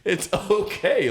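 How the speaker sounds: high, stretched-out plea through laughter; mock calming down. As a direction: talk down an angry stranger, amused. Acted bystander calming angry customer.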